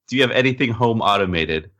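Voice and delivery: ordinary talk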